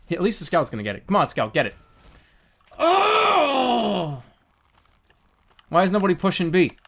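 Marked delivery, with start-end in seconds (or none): 2.8-4.2 high, falling wail of dismay; long wailing cry. Real, not acted. Gamer watching the play go wrong, high wailing cry.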